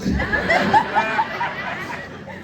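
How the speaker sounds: high, sinister giggle; creepy high cackle. Pretend. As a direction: cackle like the monster under the bed. Creepy kid show bit.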